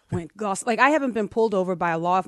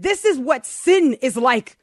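slow, louder, higher retold lecture; emphatic moralizing; 7 semitones higher, 8 dB louder, slower than usual. Mother telling the kids about sin, emphatic moralizing. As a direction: preach the lesson at the kids, drawn out.

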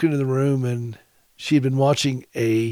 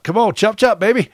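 higher and louder, hammed-up bossy pep talk; mock hurry-up; 8 semitones higher, 5 dB louder than usual. Husband teasing his wife to get to the microphone, mock bossy. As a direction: playfully crack the whip, higher and louder.

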